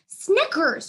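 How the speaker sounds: high, loud exclaim. A narrator's reading. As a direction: stop the dog with a sharp call. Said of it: "Julia exclaims".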